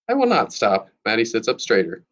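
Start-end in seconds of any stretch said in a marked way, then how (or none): 0.1-0.9 high-pitched, defiant schoolgirl; indignant refusal. A narrator's reading. Maddie refuses classmate's plea to stop, indignant.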